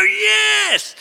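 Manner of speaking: high, loud cheering crowd. Retold or imitated. Crowd answers the tightrope walker with a loud high cheer. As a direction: yell it like an excited crowd. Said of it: "And they go".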